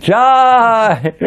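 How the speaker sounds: very high, long-held shout; loud exultant cry, stretched out; acted cheer. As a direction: imitate devotees bursting into a cheer. Devotees' cheer acted in story.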